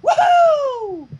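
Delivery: very high, loud whoop; gleeful cheer. Acted. Pinkie whooping at the treasure hunt plan. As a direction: whoop with joy.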